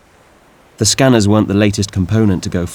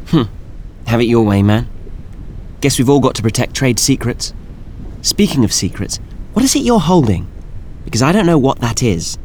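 put-on high nasal young woman; 3 semitones higher than usual. Althea voiced, high nasal.

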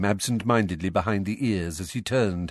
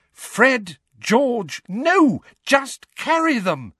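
high, loud maternal shriek; 5 dB louder, much slower than usual. A narrator's reading. Missus Weasley stopping the twins' magic, shrieking. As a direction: cry out to stop them at once. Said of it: "Missus Weasley shrieked".

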